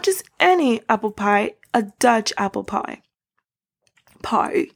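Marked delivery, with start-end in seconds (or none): 4.2-4.7 PIE said low with an odd vowel; odd pronunciation. Real, not acted. Vlogger slips while naming a Dutch apple pie, odd pronunciation. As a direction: drop low on an oddly pronounced PIE. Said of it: "why did I say it like that".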